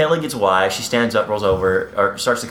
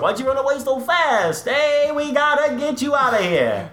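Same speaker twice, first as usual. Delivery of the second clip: much higher, slow hammed-up mobster voice; mock Italian tough guy; 12 semitones higher than usual. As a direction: ham up a cartoon Italian goon. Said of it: "Every sentence is with".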